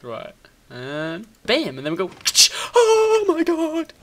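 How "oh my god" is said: high, loud, drawn-out wail of delight; amazed cry. Real, not acted. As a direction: wail it slowly in thrilled disbelief. Amazed that the contraption works.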